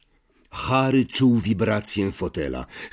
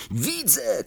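higher but contained exclamation; narrated cry; 5 semitones higher, 4 dB quieter than usual. A narrator's reading. Harry spots the train below, understated cry. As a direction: call it out without much volume. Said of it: "krzyknął Harry".

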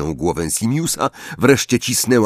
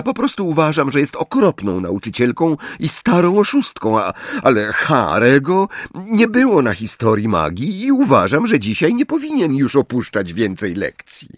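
Hermione; 7 semitones higher than usual. A narrator's reading. Hermione voiced, high.

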